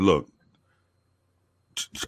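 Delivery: ordinary talk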